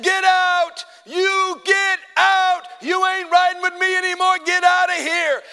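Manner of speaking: high, full-force preacher's yell; acted furious eviction, words held long; commanding shout. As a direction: cast the devil out at the top of the voice. Retold ordering devil out of car.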